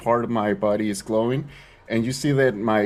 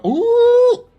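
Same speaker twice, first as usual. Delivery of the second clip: thrilled surprise; 8 dB louder than usual. Real, not acted. Player shrieks at his natural twenty, delighted. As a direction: pitch jumps way up, slowing on the ooh.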